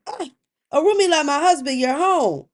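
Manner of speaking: loud, high exclamation of surprise; startled greeting. Acted. Wife caught divining greets returning husband, startled. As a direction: call it out high, caught off guard.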